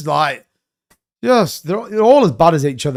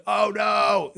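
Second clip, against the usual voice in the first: dismayed; 4 semitones higher, 5 dB quieter than usual. Real, not acted. Reacting to stolen XRP news.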